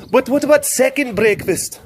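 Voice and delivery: put-on high-pitched comic Scottish accent. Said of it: "I don't like the Scottish accent".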